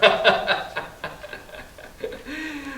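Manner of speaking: laughing aloud at his own joke; a big amused laugh; preacher laughing at the punchline; hearty laugh. Real, not acted.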